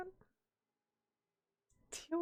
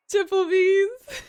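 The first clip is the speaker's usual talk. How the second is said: loud, high, drawn-out shout; playful stretched high yell; mock exclamation; 8 semitones higher, 11 dB louder, slower than usual. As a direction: belt the silly name out slowly. Mock announcing a silly name.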